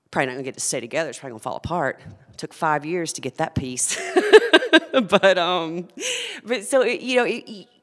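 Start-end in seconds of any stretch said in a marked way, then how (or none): 4.0-4.9 loud high laugh; sharp high laugh; burst of laughter. Real, not acted. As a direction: laugh out suddenly at the memory. Bursts out laughing mid-story.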